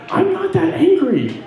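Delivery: high, slow protest of innocence; mock-indignant protest. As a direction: stretch out a high-pitched comic denial. Denying granddaughter's grumpy label.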